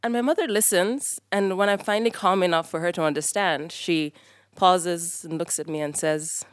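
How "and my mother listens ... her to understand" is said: ordinary delivery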